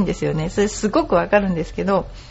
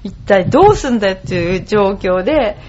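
laughing while talking; 3 semitones higher, 5 dB louder than usual. Real, not acted. Laughing at being stuck with English.

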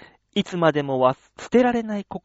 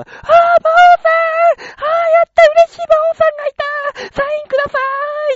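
very high, loud squealing fan voice; high-pitched fan lady; 10 dB louder than usual. Retold or imitated. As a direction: squeal like an excited middle-aged fan. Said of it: "高い声で言うもんだから". (興奮したおばさんの甲高い声)

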